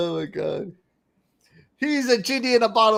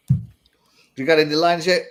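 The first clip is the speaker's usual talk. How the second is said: normal talking voice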